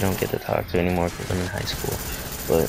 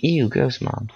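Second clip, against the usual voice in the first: put-on high-pitched grossed-out kid; play-acted squeamish whine; mock disgust; 5 semitones higher, slower than usual. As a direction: imitate a child recoiling from mom's kiss. Child refusing mother's kiss.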